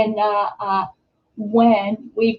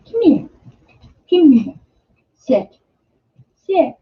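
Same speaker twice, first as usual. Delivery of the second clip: calling the dog; 3 semitones higher than usual. Real, not acted. Owner calls dog Ranger to the camera, high coaxing call. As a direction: call the dog over, pitched up.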